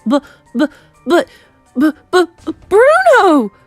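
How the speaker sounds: loud broken stutter climbing to a cry; shocked stammer. Acted. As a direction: splutter on each B, then burst out. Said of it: "stammered Mister Jenkins again".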